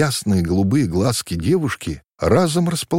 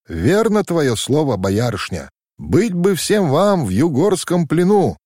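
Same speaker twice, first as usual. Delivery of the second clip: higher, lightly voiced young lad; ringing boy's voice; 8 semitones higher than usual. A narrator's reading. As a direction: call out brightly from behind the bushes. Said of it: "послышался чей-то звонкий голос". (звонкий юношеский голос, чуть выше)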